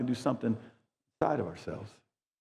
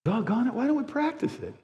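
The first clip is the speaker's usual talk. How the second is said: full-force preaching; 10 semitones higher than usual. Real, not acted. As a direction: preach it out passionately to the room.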